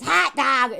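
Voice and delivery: loud put-on Illinois accent; mock Illinois exclamation. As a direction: punch it out with Illinois vowels. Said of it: "I picked up an Illinois accent".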